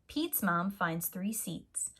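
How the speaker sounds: ordinary delivery, nothing marked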